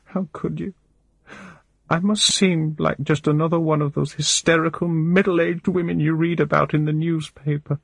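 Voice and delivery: high, wavering voice, crying through every line; a desperate woman sobbing out her despair; tearful distraught woman. A narrator's reading. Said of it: "but I can't stop crying".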